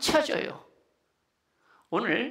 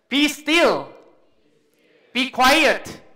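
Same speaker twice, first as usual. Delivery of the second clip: loud, raised command to the storm; commanding call; 4 semitones higher, 10 dB louder, much slower than usual. Shown as a demonstration. Preacher shows congregation how to command the storm, commanding shout. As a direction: call it out forcefully, pitch lifted. 여러분, 외치시는 거예요.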